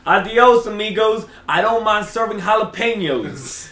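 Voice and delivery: high, loud, slow taunt; old cashier calling after customers, slow and high; mocking old cashier. Cashier mocks leaving customers.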